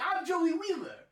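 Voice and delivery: very high cartoon Brooklyn voice; squeaky cartoon Joey; Joey Wheeler voice. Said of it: "Joey's not like that".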